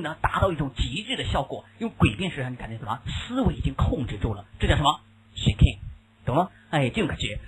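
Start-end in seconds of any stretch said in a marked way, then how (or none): none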